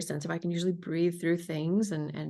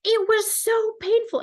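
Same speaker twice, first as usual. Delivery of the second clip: high, loud and stressed on so; patient recalling the pain, high and loud; emphatic patient; 6 dB louder than usual. Retold or imitated. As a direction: wail how much it hurt. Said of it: "They go".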